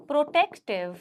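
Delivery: word by word, slowly, for students to write; slow dictation. Acted. Teacher dictating notes to her students, slow and spaced. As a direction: spell it out slowly so the class can copy.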